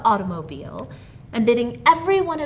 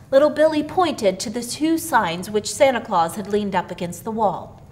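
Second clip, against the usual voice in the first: ordinary delivery, nothing marked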